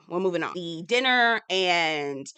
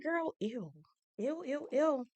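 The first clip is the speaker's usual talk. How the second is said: soft, high squeamish recoil; grossed out, high and small; disgusted ew; 7 semitones higher, 9 dB quieter, slower than usual. Retold or imitated. Acts out her disgusted reaction.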